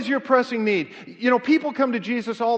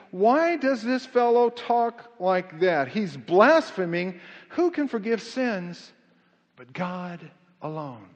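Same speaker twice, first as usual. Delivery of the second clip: slow indignant quote; 3 semitones lower, slower than usual. Acted. Voicing the teachers' doubts.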